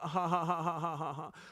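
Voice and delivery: odd breathy laugh; a strange, airy giggle; weird laugh. Real, not acted. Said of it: "That was a weird laugh".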